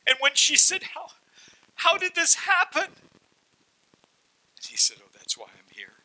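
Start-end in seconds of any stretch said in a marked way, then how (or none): 0.9-2.8 high, slow cry of wonder; a wife stunned to see her husband healed; astonished wife. Acted. 5.0-5.9 hushed testimony. Acted. Husband telling softly of Jesus.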